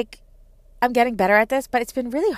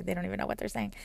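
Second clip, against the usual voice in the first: usual speaking voice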